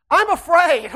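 ordinary delivery

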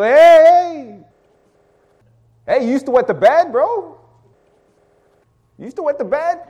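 high, loud heckling call; mock taunting. System reminding a bro of long-past shame, mock taunting. Imagined taunt twenty years later.

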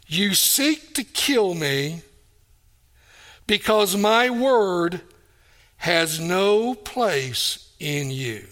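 slow, each word weighed and stressed; slow emphatic reading. Shown as a demonstration. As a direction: lay out the verse slowly so it sinks in. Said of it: "notice what he says there".

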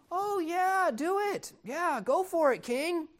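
a high mock chorus telling the king what he wants to hear; eager yes-men. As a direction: chirp it like fawning courtiers. Flattering prophets answer the king.

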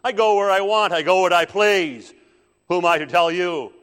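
high, defiant full-voiced shout; Satan, sassy defiance. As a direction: yell back insolently, answering to nobody. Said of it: "to that defiance and to that sass".

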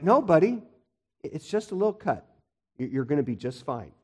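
soft, calming parent voice; reassuring dad. Retold or imitated. Dad to hurt kid after a tiny cut, gently reassuring. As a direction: talk an upset kid down, softly. Dad soothing panicking child, holding laugh.

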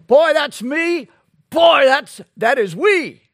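the congregation's happy admission; excited confession. Retold or imitated. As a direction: exclaim it like a sudden realisation. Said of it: "Can we say".

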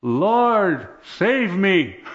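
high, loud, drawn-out plea to heaven; desperate cry for help. Retold or imitated. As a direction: call out as if sinking, each word stretched. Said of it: "I threw my hands in the air".

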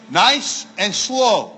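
slow, deliberate words; nice and slow. Shown as a demonstration. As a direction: draw out each word evenly. Models slow reading while saying it.

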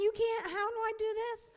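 loud, high-pitched comic panic; acted panic. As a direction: blurt it out like someone left alone out of their depth. Panicked thought when left alone.

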